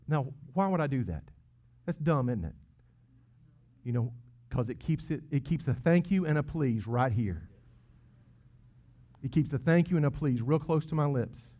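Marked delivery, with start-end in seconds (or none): none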